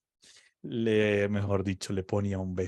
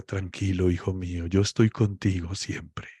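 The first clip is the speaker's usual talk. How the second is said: tender reassurance; 6 semitones lower than usual. Retold or imitated. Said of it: "esperando que Dios le diga".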